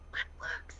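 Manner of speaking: gefluisterd, verbaasd; whispered. Retold or imitated. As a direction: fluister het, verward. Retold confused reply to coworker.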